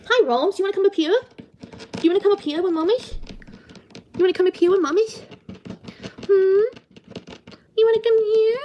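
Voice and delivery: high coaxing mommy voice. Real, not acted. Mother coaxing her baby to come up.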